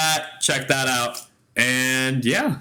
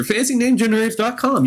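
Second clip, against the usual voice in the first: ad read; 9 semitones higher, faster than usual. Acted. Ad plug called out.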